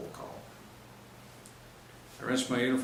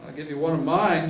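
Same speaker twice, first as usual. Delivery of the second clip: higher and louder bragging; a crude boast to the squad, louder; 6 semitones higher, 8 dB louder than usual. Acted. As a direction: brag crudely to the room. Cop boasting, grabbing crotch.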